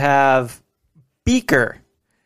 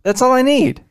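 high mock voice; 10 semitones higher than usual. Voicing Gonzo's wish.

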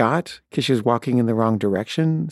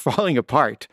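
ordinary delivery

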